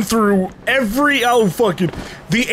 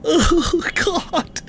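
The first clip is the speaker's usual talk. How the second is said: higher, softer bewildered voice; 7 semitones higher, 6 dB quieter, slower than usual. Real, not acted. Streamer lost in the heat, higher stunned confusion.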